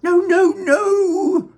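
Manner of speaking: high, loud panicked no; alarmed cry. Acted. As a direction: cry out to stop him. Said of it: "cried Mister Wonka".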